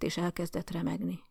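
plain reading voice

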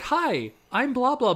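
high, lightly mocked introduction; chirpy icebreaker imitation. Girl meeting strangers abroad, mock icebreaker. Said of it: "her icebreaker".

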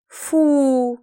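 drawn-out disgusted "fu"; squeamish, stretched-out groan of disgust. Acted. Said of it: "брезгливо протянула Кэт".

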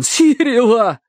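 high, urgent plea; gasped cry. A narrator's reading. Terence wants his dog rescued first, gasped cry. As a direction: gasp the name out with effort. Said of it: "выдохнул он".

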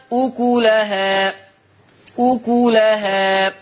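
"أكلها" as ukulahā, slow and drawn out; ضم الكاف. Shown as a demonstration. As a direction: chant "أكلها" slowly with a u after the k. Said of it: "تقرأ بضم الكاف". ("أكلها" بضم الكاف، بترتيل بطيء)

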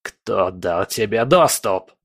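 slow, hoarse villain voice; horrified croak. A narrator's reading. Villain demanding who gave access, hoarse with dread. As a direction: rasp the question out slowly in horror. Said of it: "прохрипел Легба в ужасе".

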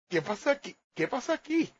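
ordinary talk

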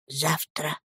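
very soft, low word; whispered answer. A narrator's reading. Nanny breaking news to the boy, very quiet low whisper. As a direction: barely let the word out. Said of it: "шепчет Пиготи".

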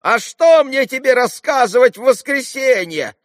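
very high, loud market-woman tirade; indignant yelling. A narrator's reading. Trader scolding the boy who pestered her, yelling. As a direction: yell indignantly at a pest. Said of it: "раскричалась Марья".

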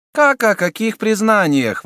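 high, loud astonished prelate; astonished exclamation. A narrator's reading. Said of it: "воскликнул изумлённый прелат".

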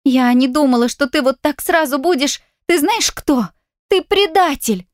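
high, hurt and accusing; tearful accusation. A narrator's reading. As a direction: accuse him, near tears. Accusing him through tears.

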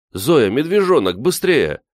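high, eager exclamation; excited call. A narrator's reading. Calling Zoya to see the bear cub, excited. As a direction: summon her to look at once.

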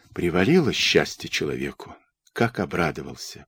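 low, hushed inward musing; involuntary envy. A narrator's reading. Dmytro watches his friend run to his sweetheart, quietly envious. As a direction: think it softly to yourself with a touch of envy. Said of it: "подумал он с невольной завистью".

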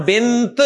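بنت in pause, with the final t released; stopping on t. Shown as a demonstration. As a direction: stop on بنت with a clear t. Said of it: "کیونکہ آپ رک رہے ہیں نا".

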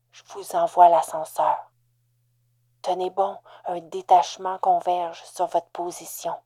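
put-on loud, pressed old man on comms; urgent old man on radio. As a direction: hold them steady under fire. Old hacker speaks over earpiece.

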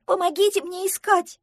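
high, loud duckling shout. A narrator's reading. Duckling begs the others to help search, shouting. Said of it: "кричал утёнок".

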